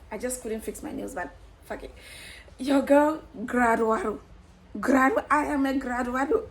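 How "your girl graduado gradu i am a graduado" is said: loud, laughing brag; excited boasting. Real, not acted. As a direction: crow the word with glee. Celebrating graduation.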